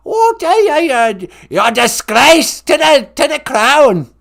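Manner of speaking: loud, high, scolding British grandmother; hammed-up royal indignation; Queen Mum. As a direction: scold like a cranky comic monarch. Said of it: "It's the Queen Mum".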